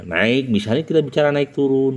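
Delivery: normal talking voice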